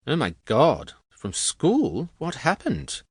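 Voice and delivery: high, startled woman's voice; incredulous, high-pitched questions; shocked surprise. A narrator's reading. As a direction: react aghast, pitch jumping up. Annie reacts shocked to expulsion.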